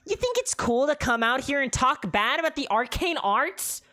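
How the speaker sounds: high, loud scolding squawk; harpy telling off the preacher; angry harpy. Acted. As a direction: scold him like a cross bird. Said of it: "with an angry look".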